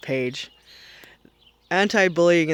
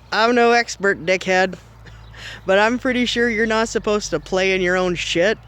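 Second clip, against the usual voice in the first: ordinary talk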